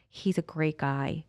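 slow, tender congratulations; heartfelt and smiling. Retold or imitated. Prison worker to waiting partner, slow and heartfelt. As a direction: say it gently through a smile. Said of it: "big breath and this beautiful smile".

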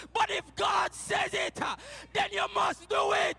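if God says it shouted high and loud; loud preaching shout. Shown as a demonstration. Preacher driving home the point, shouting. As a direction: belt it out to the church. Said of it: "repeated plain after".

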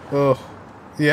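ordinary talk

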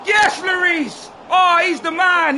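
slow, high, loud cheer of delight; stretched, delighted yell; excited cheer. Real, not acted. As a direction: celebrate the save out loud. Keeper makes a big save.

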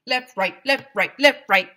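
loud, rising barked commands; barked drill. Acted. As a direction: bark the cadence harder. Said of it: "barked Doctor Carbles".

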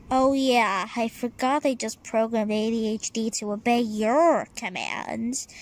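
plain reading voice

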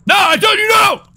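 high, loud yelled refusal; shouting. Real, not acted. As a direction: yell it at your friend. Refusing friend's nagging, shouts.

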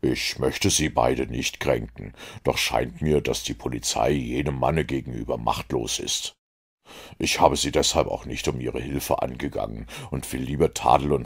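calm low-voiced detective, measured; cool, composed Sherlock Holmes. A narrator's reading.

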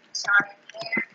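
whispered, hushed; whispering while hiding. Real, not acted. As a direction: whisper so the killer won't hear.

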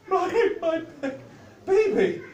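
high, halting whimper. A narrator's reading. Shocked mother points back to Casper, high stammering whimper. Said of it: "she whimpered".

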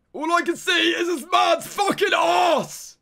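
loud, slow exasperated yell; yelling at the screen in disbelief; frustrated shout. Real, not acted. Streamer yelling at the game view, furious shout.

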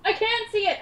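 high, loud exclamation; excited outburst. Real, not acted. Player reporting the hidden whispers, excited outburst. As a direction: cry it out to everyone.

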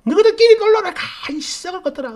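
very high, loud burst racing into a scolding; hammed-up screaming mother-in-law; mock furious yelling. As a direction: imitate her shrieking in rage. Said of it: "막 버럭버럭".

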